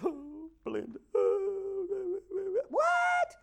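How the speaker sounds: hammed-up sobbing mourner, pitch jumping up; mock crying. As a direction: fake wailing over a lost friend. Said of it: "see a bunch of people crying".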